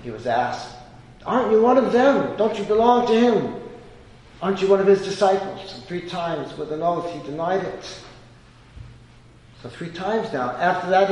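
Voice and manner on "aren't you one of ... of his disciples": loud, high accusing challenge; accusing questions. Acted. Bystanders accusing Peter in the courtyard, loud. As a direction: push the questions at him.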